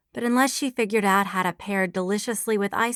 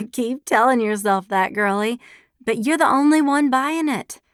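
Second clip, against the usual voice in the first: teasing laugh; 5 semitones higher, 5 dB louder than usual. A narrator's reading. Friend teasing her denial.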